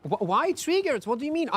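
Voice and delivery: high, puzzled why-question; surprised protest. Real, not acted. Streamer baffled by a chat comment, surprised. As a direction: pitch up in disbelief. Viewer says he was triggered.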